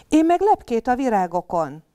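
little bunny shouting what he spotted; shouted. Acted. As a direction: yell it out, eager to be heard. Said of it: "kiabálta Bugri".

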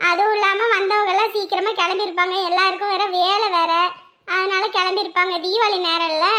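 ordinary delivery

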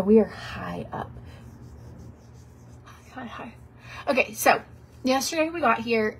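ordinary talk, nothing marked

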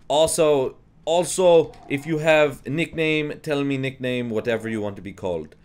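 imitated Russian teacher, starting high and loud; Russian accent. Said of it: "I'll do the accent anyways".

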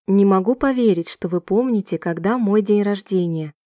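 ordinary delivery

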